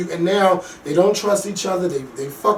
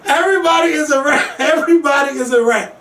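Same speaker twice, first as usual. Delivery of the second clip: high, emphatic, amused declaration; mock exclamation; 6 semitones higher than usual. Real, not acted. Host joking about the mob show, emphatic mock exclamation. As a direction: proclaim it to break everyone up. Mock verdict repeated amid laughter.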